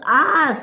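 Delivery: high wailing "ah"; cry of alarm. Acted. Annie dangling over the edge, cry of alarm.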